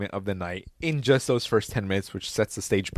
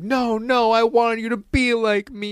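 high pleading villain voice; mock Riddler begging, higher; 10 semitones higher, slower than usual. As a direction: beg Batman like a needy villain. Riddler pleading in retold scene.

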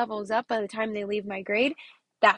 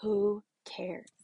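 whispered shrug; 2 semitones lower, 4 dB quieter than usual. Real, not acted. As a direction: drop to a whisper, dismissive. Whispered dismissive aside.

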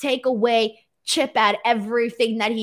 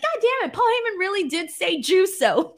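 much higher, disbelieving exclamation; mock disbelief; 9 semitones higher than usual. Retelling her reaction to Juso trending, high disbelief. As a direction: squeal it like you can't believe it. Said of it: "and I'm like".

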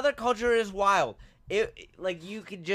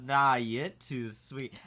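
low, slow mock Southern sweet talk; flirty Southern woman; 6 semitones lower, much slower than usual. Waitress flirting back at the customer, imitated low drawl. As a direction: imitate her turning the compliment back, drawn out. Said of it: "And she goes".

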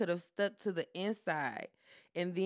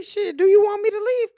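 high, loud fed-up question; shouting at the mocking lover; exasperated shout; 12 semitones higher, 11 dB louder than usual. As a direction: throw the question back hard. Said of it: "I'm like".

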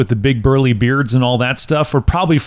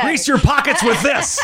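laughing; 10 semitones higher than usual. Real, not acted. Joking about bribing congressmen.

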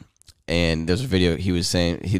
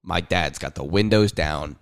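normal talking voice

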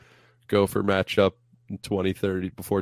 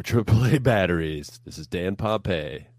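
ordinary delivery

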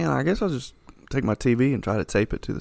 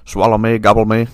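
loud comic voice; 7 dB louder than usual. Pretend. Food talking, comic.

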